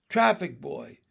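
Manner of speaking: loud call for attention. A narrator's reading. Teacher summons the boy at the crossing, calling out. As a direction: call out firmly to someone at a distance. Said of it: "Binny called out".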